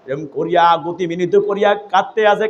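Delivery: ordinary talk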